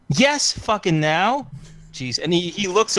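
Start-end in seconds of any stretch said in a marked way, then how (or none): 0.1-1.5 impatient demand. Acted. Demanding on the comms.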